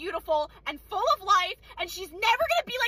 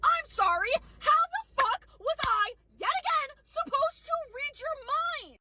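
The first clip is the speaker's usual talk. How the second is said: exasperated disbelief; 6 semitones higher than usual. Acted. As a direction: stretch out the indignant question. Acted retort to customer.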